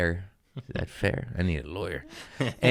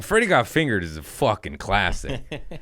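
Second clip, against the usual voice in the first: ordinary delivery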